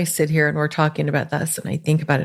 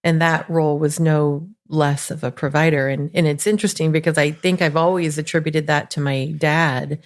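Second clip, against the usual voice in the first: normal talking voice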